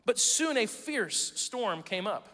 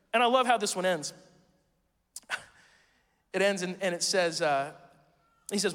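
ordinary talk, nothing marked

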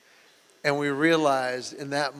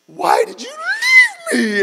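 high, loud cry of hurt from the wife; retold shout; 11 dB louder than usual. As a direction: shout it up high like she's been abandoned. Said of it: "and she goes".

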